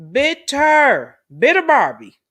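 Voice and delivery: loud mocking taunt; mocking shout. Real, not acted. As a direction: yell it at her like a taunt. Mocking the woman as bitter.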